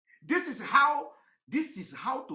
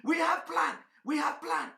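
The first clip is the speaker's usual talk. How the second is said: mock shouted boast; 6 semitones higher, 3 dB louder than usual. Mock answer to strategy question.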